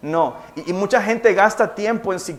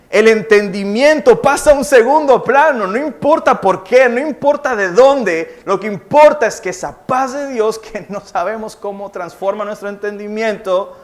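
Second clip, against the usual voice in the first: fervent preaching; 2 semitones higher, 6 dB louder than usual. Acted. Preaching at full force.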